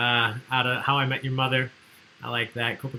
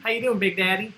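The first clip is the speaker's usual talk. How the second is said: mock greeting; 9 semitones higher, 4 dB louder than usual. Mock calling dad Big Daddy.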